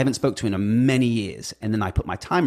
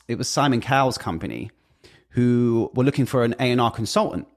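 ordinary talk

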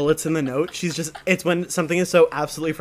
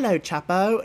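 higher mock British child's greeting; hammed-up British hello; British accent; 4 semitones higher than usual. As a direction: put on a little British kid's accent. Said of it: "They were, like, a little British".

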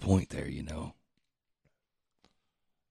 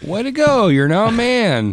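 mock proud parent; 3 dB louder than usual. Mock congratulating on period.

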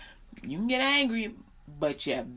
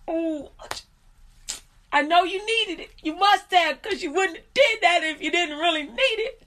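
strained forgiveness; 7 semitones higher than usual. Retold or imitated. Forcing kind words through anger.

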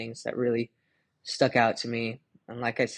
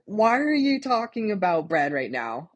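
mock Jesus; 9 semitones higher, 5 dB louder than usual. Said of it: "Jesus is like".

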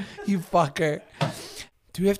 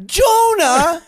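incredulous; 9 semitones higher, 7 dB louder than usual. Real, not acted. Incredulous at the suggestion.

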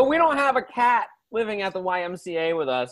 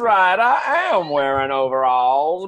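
put-on Virginia southern accent. Overalled southerner explaining his tobacco roots, Virginia accent. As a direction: boast in a broad Virginia accent. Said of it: "I come from down in the Virginia".

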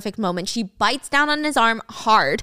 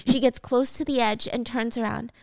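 usual speaking voice

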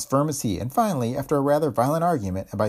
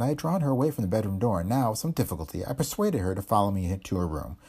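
plain reading voice

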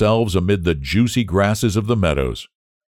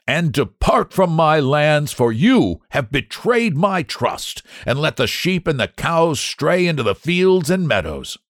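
angry scolding; 9 semitones higher than usual. A narrator's reading. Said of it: "angry tones of her father's voice".

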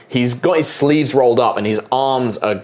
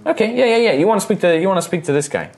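high, agreeable halfling; halfling guide; 10 semitones higher, faster than usual. Acted. Halfling points buyers to the manager, high agreeable voice. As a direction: chatter it high and obligingly.

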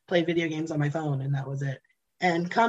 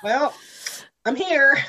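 higher, louder mock shrug; deadpan well-I'm-here; amused surprise; shrugging surprise; 7 semitones higher, 6 dB louder than usual. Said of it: "And I was like".

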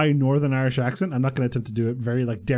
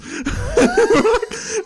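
shrieking high laugh; 3 dB louder than usual. Real, not acted. Laughing at the teacher's jibe, high shrieking laugh. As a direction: burst into a high-pitched laugh.

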